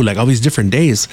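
ordinary talk, nothing marked

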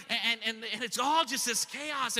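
ordinary delivery

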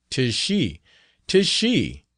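rising to a high, loud cry of recognition; excited exclaim. A narrator's reading. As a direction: call out joyfully on seeing her. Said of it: "exclaimed he".

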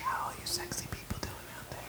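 hushed greeting to the listeners; soft mock-ASMR host; ASMR whisper. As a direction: whisper it very softly, flirting with the audience.

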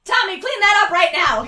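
high, loud parental order; full-volume exasperated dad yell. A narrator's reading. Dad ordering his toddler to clean up, shouting. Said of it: "yelled Stu".

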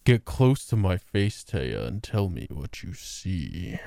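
low, slow creepy whisper-voice. Acted. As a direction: lean in and creep her out slowly. Creepy Tristan parody.

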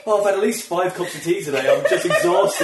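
hammed-up weary sigh; mock exhausted friend. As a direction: act worn out from tea. Mocking tea-drinker friend.

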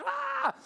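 very high mock scream; freaking-out scream. Dad imitates his son freaking out in haunted house, mock scream. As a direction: imitate a kid's high-pitched shriek. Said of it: "like, freaking out".

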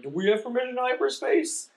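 nervous clerk asking leave; timid Vogon. Acted. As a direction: Vogon asking the home planet.

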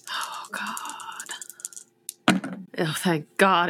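whispered dread, then relief; scared then relieved. Real, not acted. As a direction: breathe out in fear, then sag with relief. Rolling dice, tense then relieved.

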